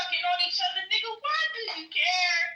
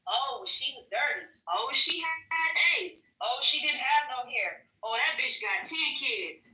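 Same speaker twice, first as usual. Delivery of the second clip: lower, lightly voiced guy trash-talking after the fact; mock bragging man; 6 semitones lower than usual. Mocking men who badmouth women they slept with, low and dismissive. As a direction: badmouth her like a careless dude. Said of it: "And then wanna talk bad about it".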